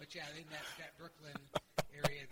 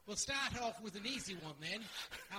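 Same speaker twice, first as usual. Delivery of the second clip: ordinary delivery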